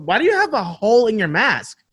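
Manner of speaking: ordinary delivery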